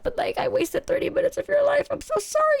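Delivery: very high, pleading mock sorry; frantic apology. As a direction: beg forgiveness up high.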